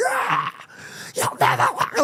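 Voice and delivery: high, strangled fake scream, held back in volume; half-voiced mock battle cry; mock crowd screaming. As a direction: imitate wild fans yelling without full force. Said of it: "screaming and like".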